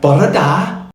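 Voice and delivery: a Welsh greeting; Welsh accent. Shown as a demonstration. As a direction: say it like a Welsh local. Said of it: "Wales ... they will say".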